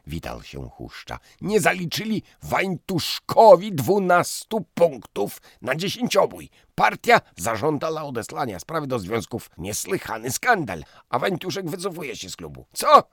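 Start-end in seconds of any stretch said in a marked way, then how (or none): 1.4-13.1 loud, high, indignant rant; agitated young man. Acted. Fiancé telling the table about the scandal, loud and indignant. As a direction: pour out the outrage breathlessly.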